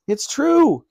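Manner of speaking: put-on high-pitched, insistent boy's exclamation; exclaiming. A narrator's reading. Ben protesting to the doubting Queen, exclaiming. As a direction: burst out in protest. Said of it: "exclaimed Ben".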